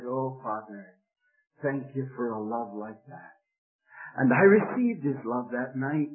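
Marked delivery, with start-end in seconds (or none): 0.0-3.3 soft, lowered voice, humbly thankful; quiet prayer. Acted. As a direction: offer it up gently as a hushed prayer. Boy's quiet prayer, acted.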